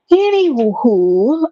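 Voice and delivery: stretched high anywho; slow drawn-out anywho. Real, not acted. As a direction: drag it out playfully.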